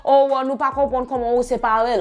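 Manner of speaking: lightly put-on French accent, mocking; mock French excuse. Mocking the family's excuse in a lightly put-on French accent. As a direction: parody them playing dumb in French. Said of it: "we don't understand how you separated it".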